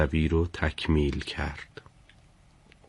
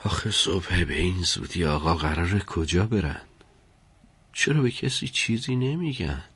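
soft, puzzled private murmur; talking to oneself; 6 dB quieter than usual. A narrator's reading. Servant wondering where his master is going, muttering to himself. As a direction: think aloud, hushed and questioning. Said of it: "با خودش گفت".

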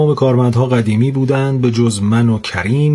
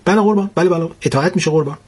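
fawning clerk; 5 semitones higher, much faster than usual. Acted. Fawning clerk to his boss.

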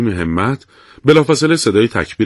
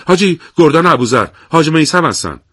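higher, louder rushed report; hurried; 6 semitones higher, 3 dB louder than usual. Acted. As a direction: blurt the news quickly. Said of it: "شتاب زده گفت".

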